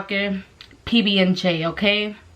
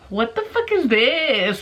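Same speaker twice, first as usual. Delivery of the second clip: retold amazement; 3 semitones higher, slower than usual. Acts out greedy delight at snacks.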